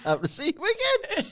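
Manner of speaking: half-laughing Australian impression; laughing through a bad Aussie accent; mock Australian. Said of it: "in an Australian accent".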